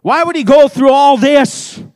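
impassioned preaching. Real, not acted.

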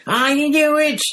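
very high, loud triumphant cry; gleeful shout. Acted. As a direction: shriek with glee at being right. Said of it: "Stan shouted gleefully".

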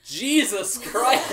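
slow, loud laughing outburst; exasperated shout. Real, not acted. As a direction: groan it out loud while laughing. DM exasperated again.

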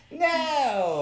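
high-pitched theatrical cry of protest; mock outrage. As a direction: wail it in comic disbelief. Mock outrage at reports being trashed.

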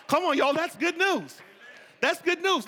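loud, high, triumphant; loud, high preaching; preaching climax. Acted. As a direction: shout the good news to the room. Preaching at full force.

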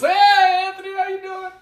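a cheesy announcer's hello; over-the-top radio host voice. Retold or imitated. Greeting his guest once recording starts, hammed-up announcer. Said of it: "once I hit the record".

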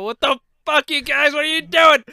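high, loud imitated outrage; mock angry drivers. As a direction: play the furious driver shouting. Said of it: "They go".

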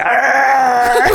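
a gruff imitated bark; mock bark. Retelling how the vagrant barked at children, mock bark. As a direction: bark at the kids like a dog. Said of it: "les ladraba".

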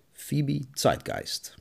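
slow, drawn-out announcer voice over music; old-fashioned radio serial announcer; radio drama title announcement. Acted. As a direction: announce the show title grandly and slowly.

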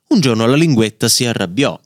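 ordinary delivery, nothing marked

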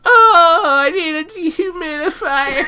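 high mock whine; whiny mock voice. Mocking Archie.

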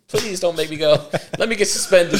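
high-pitched mock begging; pleading. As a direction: beg Mom like a kid in trouble. Kid begging mom, retold.

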